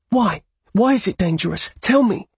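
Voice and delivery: high, insistent angry questions; angry demand. Acted. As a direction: demand an answer angrily.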